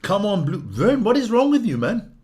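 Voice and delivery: high, loud exasperated protest; real exasperation, pitch jumping up; exasperated outburst. Real, not acted. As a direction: demand an answer in disbelief.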